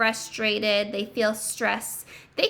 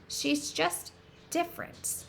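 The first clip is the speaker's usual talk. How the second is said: gentle reassurance; 5 semitones higher, 7 dB quieter than usual. Acted. Reader reassures the children.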